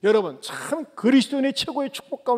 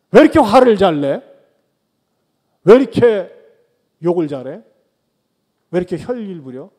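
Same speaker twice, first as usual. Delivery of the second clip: mock angry scolding; 2 semitones lower, 10 dB louder than usual. Mock scolding hot-tempered person.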